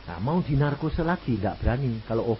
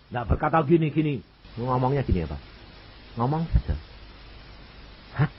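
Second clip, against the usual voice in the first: ordinary talk, nothing marked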